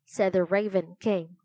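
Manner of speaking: plain reading voice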